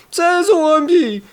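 very high slow panicked wail; terrified shriek. A narrator's reading. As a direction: stretch out the scream in horror. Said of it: "верещу я".

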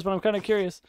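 ordinary talk

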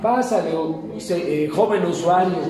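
mock polite welcome. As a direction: act out the polite staff greeting. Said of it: "no creas que fueron amables, como ahorita".

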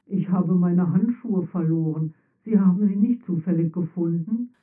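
a touch of hope in the tinny armor voice; lightly voiced armor hoping for help; hopeful. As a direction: ask as if it's your last chance. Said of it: "fragte die Stimme hoffnungsvoll".

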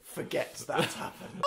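hushed. Real, not acted. Embarrassed hushed aside.

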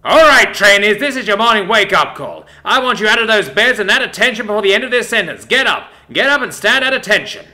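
drill sergeant shout. Acted. Drill instructor shouting trainees awake, loud and high. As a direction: bellow it, high and loud, like a barracks wake-up.

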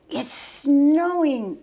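high, loud, drawn-out gasp of wonder. A narrator's reading. Cub at the window sees snow, gasping. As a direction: marvel at it, stretched out slowly. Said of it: "grasped Button".

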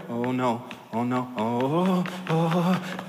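soft, low, scared "oh no" in a mild imitation; man mocks his date's muffled fear at the movie, softly; retold frightened moan. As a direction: mimic a date spooked by a cartoon bear, quietly. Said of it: "I hear her go".

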